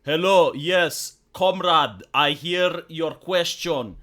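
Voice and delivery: mock Eastern European accent, stiff and comradely; Kamala Harris. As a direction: put on a thick fake Slavic accent. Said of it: "immediately loading accent".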